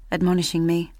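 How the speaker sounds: usual speaking voice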